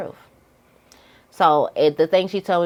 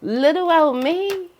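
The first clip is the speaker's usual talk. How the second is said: high, slow mock-innocent voice; mock innocence; 11 semitones higher, slower than usual. Feigning innocence to an accuser in a high slow voice. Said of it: "It's like".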